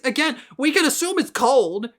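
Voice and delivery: ordinary talk, nothing marked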